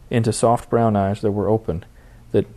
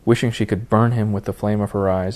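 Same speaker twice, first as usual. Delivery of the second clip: usual speaking voice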